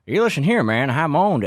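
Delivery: high, loud mock Biden; Biden impression. Mocking Biden to the viewers, high and loud. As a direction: squeal it like a mocked Biden. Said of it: "He said".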